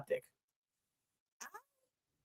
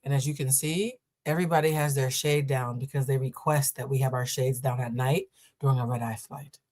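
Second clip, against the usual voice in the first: calm restraint; 6 semitones lower than usual. Retold or imitated. To the seatmate over the shade, low calm restraint. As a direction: talk her down with patience. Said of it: "I said".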